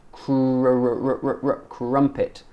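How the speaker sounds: "Cr-, r-, r-" stuttered out, then "crumpet"; isolated R drill. Shown as a demonstration. Teacher drilling the R, repeated smooth R leading into "crumpet". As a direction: repeat the R of "crumpet" before the word. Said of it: "Look at my mouth".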